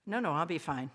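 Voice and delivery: ordinary talk, nothing marked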